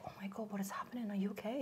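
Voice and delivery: whispered, rapid worried questions; hushed concern. Retold or imitated. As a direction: imitate a colleague whispering in alarm. Concerned colleague enters, retold.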